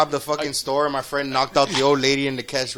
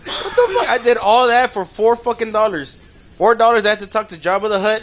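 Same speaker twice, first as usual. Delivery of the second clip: yelled in disbelief, louder and higher; outraged shout; 5 semitones higher, 5 dB louder than usual. Real, not acted. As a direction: blow up over the tiny cut. Outraged over four dollars loot.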